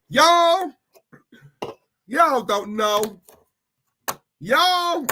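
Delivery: drawn-out, high American "Y'all"; American accent. Acted. Streamer teasing chat in American accent, drawn out. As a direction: stretch "Y'all" like a Southern American. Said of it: "You're making all of us speak American now".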